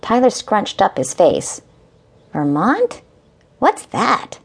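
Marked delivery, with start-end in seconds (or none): 2.3-4.4 put-on puzzled little boy. Child puzzled, scrunched face.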